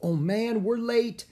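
high, loud dismay; worried kid's exclamation; alarmed. A narrator's reading. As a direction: cry out on realizing you're late. Jack realises they are late.

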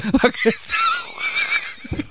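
very high, slow mocking squeal; ridiculing the shower idea; mocking echo. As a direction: drag it out in a silly falsetto. Mocking co-host's suggestion.

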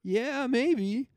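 high, vague shrugging voice; noncommittal studio voice. Retold or imitated. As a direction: dodge it like a studio exec. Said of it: "then they'll say".

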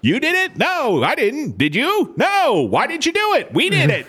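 rapid question-and-answer in a high falsetto; mocking impression of the show. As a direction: send up sitcom bickering way up high. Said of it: "You did a fucking awesome impression".

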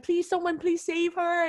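high, pleading cry for help; high tearful plea of a child. Retold or imitated. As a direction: beg them to save her. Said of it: "crying so hard".

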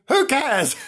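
laughing mock heckle; mock yell. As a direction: toss it off like a heckler shouting back. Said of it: "some people are yelling".

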